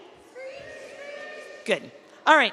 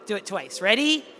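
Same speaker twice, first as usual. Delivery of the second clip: ordinary talk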